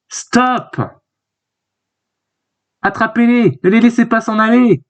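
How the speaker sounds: high-pitched calling out, no extra volume; urgent acted command. Character ordering guards to catch fugitives, high urgent voice.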